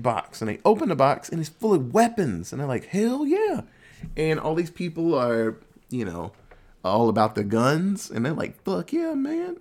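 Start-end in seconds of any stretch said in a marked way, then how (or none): 2.9-3.6 high-pitched gung-ho cheer; retold excited cheer. People finding a box of weapons, high excited cheer. 8.6-9.6 high, mock-pumped whoop of approval; retold gung-ho cheer. Gun lovers handed weapons, high excited cheer.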